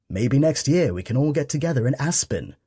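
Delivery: a lightly put-on posh accent, half joking; fakey posh accent. Son jokes to parents in mild mock posh accent. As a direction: son hams up a lightly posh rich-folks voice. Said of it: "he said in a fakey posh accent".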